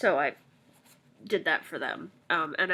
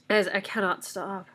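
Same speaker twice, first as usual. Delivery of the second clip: congested, whispering through a blocked nose; sick and stuffed up, barely voiced; stuffy nose; 2 semitones higher than usual. Real, not acted. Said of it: "I'm so stuffy".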